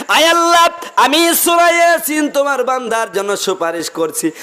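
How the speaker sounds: high, loud cry to God, easing down; preacher voicing an impassioned plea; retold tearful plea. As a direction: call out an imploring prayer, acted, without sobs. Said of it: "কেঁদে কেঁদে বলেন".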